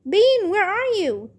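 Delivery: high-pitched searching call; worried yelling. A narrator's reading. Babysitter searching for the trapped girl, worried yelling. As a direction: call out urgently for a lost child. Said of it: "Nancy was yelling too".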